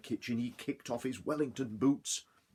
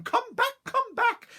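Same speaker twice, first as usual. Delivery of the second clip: very high, loud shriek; 9 dB louder, slower than usual. Acted. Wife chasing with her rolling pin, very high loud shriek. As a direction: screech after him.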